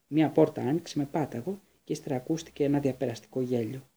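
usual speaking voice

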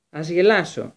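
ordinary delivery

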